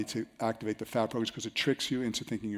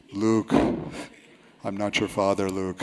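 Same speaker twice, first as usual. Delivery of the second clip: lightly voiced Vader, a touch slow; a hint of the movie villain, half-joking; Darth Vader; 5 semitones lower, slower than usual. Joking about the caller's breathing, lightly voiced Vader. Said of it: "he would talk like this".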